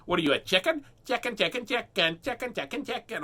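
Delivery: high, singsong chicken taunt; imitating a child chanting chicken; taunting girl. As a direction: tease him like a bratty kid.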